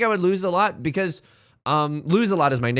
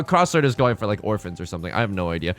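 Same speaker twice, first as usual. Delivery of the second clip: usual speaking voice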